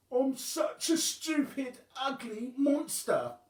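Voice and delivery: high, loud, slow wail of a sad monster; yelling monster. Acted. Wobbling yelling her misery to the sky, high and loud. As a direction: cry it out to the sky. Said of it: "she'd yell up to the sky".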